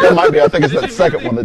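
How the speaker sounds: squeaky laughing voice cracking high. Real, not acted. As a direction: laugh through it with the voice breaking. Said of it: "are you going through puberty again".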